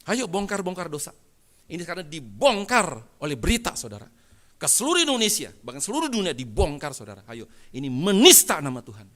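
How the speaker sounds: loud, challenging pulpit delivery; fired-up preacher; forceful preaching. Real, not acted. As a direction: challenge the congregation loudly, punching key words.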